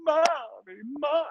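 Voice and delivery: high-pitched mock wail; escalating child whine. Kid next door calling mom, escalating whine. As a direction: imitate a kid's whine getting bigger. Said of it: "whining is now escalating, w-, bigger and bigger".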